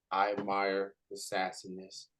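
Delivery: ordinary talk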